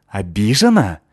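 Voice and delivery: loud, incredulous throwing back of the word; indignant echo. A narrator's reading. Girl outraged at being called offended, indignant.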